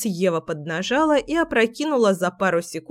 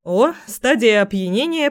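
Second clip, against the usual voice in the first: plain reading voice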